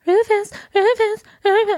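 high, loud mimicked pet call; imitated calling out. Calling for a missing cat, high mimicked calls. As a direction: call the name over and over, high-pitched. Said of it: "she just kept going".

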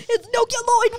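put-on It's nucleoid war! yelled high and loud, heavy accent; imitating the Grease 2 character's accent; heavy movie accent. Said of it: "That's how he says it".

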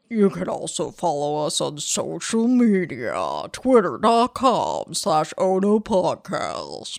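strained put-on voice. Host plugging social media to listeners, straining a silly voice. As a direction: silly voice that hurts to do. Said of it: "This really hurts".

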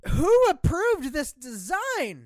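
high, drawn-out disbelief; incredulous outcry. Acted. Co-host reacting to an ugly console design, incredulous outcry. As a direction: stretch it out in high-pitched exasperation. Mock outrage at a product design.

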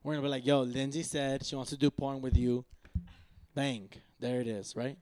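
ordinary talk

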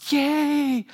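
put-on high squeaky child's "Yay!"; little boy shouting yay; excited kid cheer. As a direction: cheer like a thrilled five-year-old.

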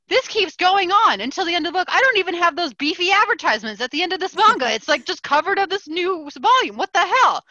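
high, loud exasperated outburst; exasperated disbelief. Retold or imitated. Reader to friends on finding a misprinted book, exasperated disbelief.

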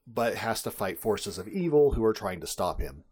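usual speaking voice

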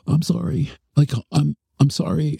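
gasp, then high-pitched imitation of her; mock her sudden apology in a high voice; startled apology.

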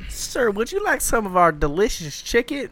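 mock cashier. Mock server pitch.

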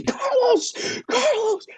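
high, urgent repeated name; urgent calling out. Retold or imitated. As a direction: grab his attention mid-play. Analyst elbowing him mid-call.

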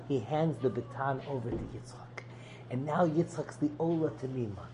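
hushed whisper; whispered to the class. Real, not acted. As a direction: drop to a whisper, letting the moment land. Hushed climax of the story.

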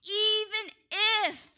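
EVEN punched high and loud, then a pause; emphatic even if. Real, not acted. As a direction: hit "even" hard and high, pause before "if". Preacher building to emphatic peak.

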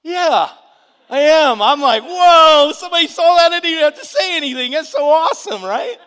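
high, very loud elated outburst; hammed-up ecstatic yelling; overjoyed new believer. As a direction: shout it out, thrilled to be noticed. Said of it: "I'm new in the Lord. Understand, I'm like".

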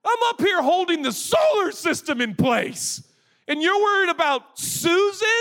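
hammed-up impatient God; mock fed-up shouting; exasperated rant. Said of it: "I'd be like".

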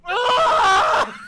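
loud, high scream of disbelief. Real, not acted. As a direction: scream because words fail you. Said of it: "so I just fucking screamed".

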